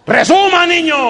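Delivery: high, loud bark of impatience; impatient shout. Acted. Hurried priest shouting at the stammering child to sum it up. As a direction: snap at the child to hurry up.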